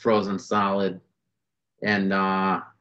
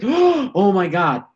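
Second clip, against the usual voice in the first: high, drawn-out, louder awe; reenacted amazement; 10 semitones higher, 5 dB louder, much slower than usual. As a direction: stretch it out high, as if stunned.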